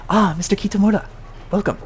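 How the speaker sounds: a suave host's welcome; smooth receptionist greeting. Acted. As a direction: greet him with practiced smoothness. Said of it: "He just says".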